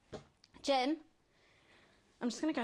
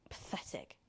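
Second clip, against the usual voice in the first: low whispered sneer; scornful whisper; 8 dB quieter than usual. Acted. Villain whispers scorn at Alex, whispered. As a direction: breathe the word with contempt.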